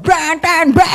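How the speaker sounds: honking, high-pitched B; B pakai klakson. Acted. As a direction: blare the letter B like a car horn. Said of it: "pakai klason". (ngegas seperti bunyi klakson)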